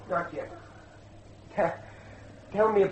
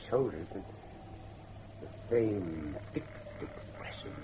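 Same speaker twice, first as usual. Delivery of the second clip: low awed unease; 7 semitones lower, 8 dB quieter than usual. Acted. Guest stares at grotesque portrait.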